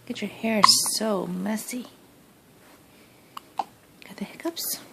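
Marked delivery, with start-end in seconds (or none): 1.3-4.8 whisper. Real, not acted. Whispering to baby.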